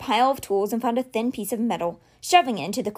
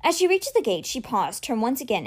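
ordinary delivery

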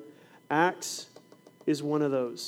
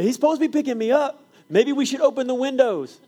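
much higher, louder teasing; high loud playful announcement; mock excitement; 10 semitones higher, 6 dB louder than usual. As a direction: hype up the class in fun. Teacher teasing class.

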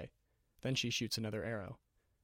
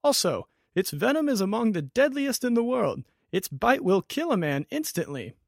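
higher and louder, urgent; shouted warning; 9 semitones higher, 12 dB louder than usual. Acted. Wizard still shouting warnings to Ariana. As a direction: keep yelling the danger across the square.

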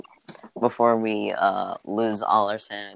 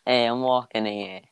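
New York accent with dropped h. Shown as a demonstration. Voice actor showing co-host the line, New York accent. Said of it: "I can also do New York".

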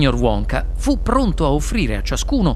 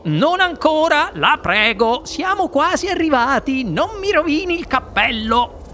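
high-pitched, urgent begging; anxious plea with pitch raised; flustered pleading; 7 semitones higher than usual. Acted. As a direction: plead hastily to hold off. Wonka begs her not to be sick.

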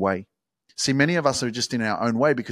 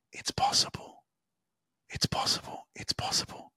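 breathy whisper, eyes closed; whispered affirmation; 9 dB quieter than usual. Shown as a demonstration. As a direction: whisper it intimately like a mantra. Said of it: "I got my eyes closed".

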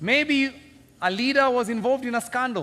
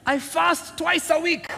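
boastful Pharisee; 9 semitones higher, 4 dB louder, much slower than usual. Retold or imitated. Pharisee boasting in quoted prayer.

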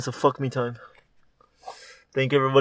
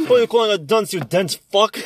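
mock indignation; 6 semitones higher than usual. Mock insult, raised voice.

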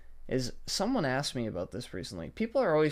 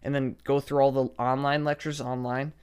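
ordinary talk

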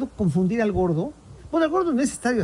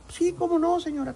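high, eager-to-please yes; obliging fat guy; 8 semitones higher, 3 dB quieter than usual. Retold or imitated. Host agreeing to the mother's request, high and obliging. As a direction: agree a bit too sweetly. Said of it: "Y el gordo".

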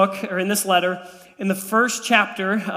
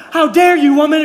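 loud, high-pitched pretend indignation; mock outrage; 7 semitones higher, 9 dB louder than usual. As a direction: blow up at your wife as an obvious joke. Mock outburst at wife, then denies it.

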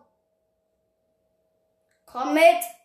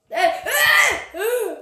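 laughing; 4 semitones higher, much slower than usual. Real, not acted. Laughs during chase.

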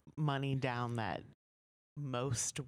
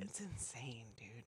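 whisper; 2 semitones lower, 12 dB quieter than usual. Real, not acted. Shocked aside.

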